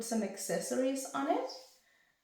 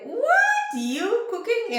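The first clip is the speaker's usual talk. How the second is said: much higher and louder, squealing in disbelief; shocked disbelief; 14 semitones higher, 10 dB louder, slower than usual. Retold or imitated. Siblings hearing she cooks, in loud high disbelief. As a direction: act the siblings' stunned shock. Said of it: "But they were like".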